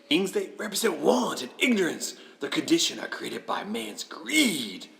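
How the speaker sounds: lightly voiced grand spirit; solemn spirit. Spirit revealing want and ignorance, lightly dramatic. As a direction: pronounce it as a mildly ominous ghost.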